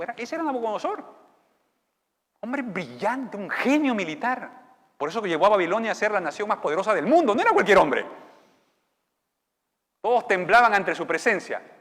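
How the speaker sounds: loud, rising sermon; full-force preaching. Acted. Preacher to church, thundering over the king's might. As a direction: build it up to a preaching roar.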